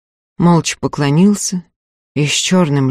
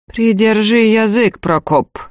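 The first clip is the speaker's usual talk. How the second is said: cold; 6 semitones higher than usual. A narrator's reading. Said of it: "холодно сказала Веневицкая".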